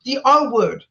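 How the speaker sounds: hurt, forceful protest; indignant outburst. Real, not acted. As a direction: hurl out each offending term in real upset. Upset outburst listing hurtful slurs.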